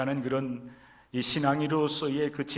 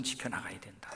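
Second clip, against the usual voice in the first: whisper; 7 semitones lower, 11 dB quieter than usual. Real, not acted. Whispered exhortation.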